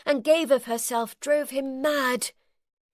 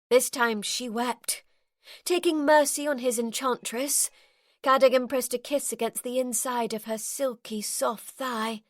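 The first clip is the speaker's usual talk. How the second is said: ordinary delivery, nothing marked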